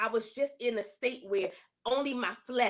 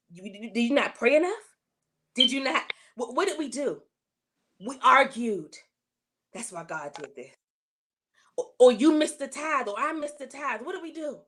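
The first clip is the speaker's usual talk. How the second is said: higher-pitched, heated retold questions to husband; a touch of retold blame; retold angry accusations; 4 semitones higher, 4 dB louder than usual. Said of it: "I was mad at my husband".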